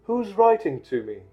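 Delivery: high, insistent question; Harry. Acted. Boy questions uncle about letter, high and insistent.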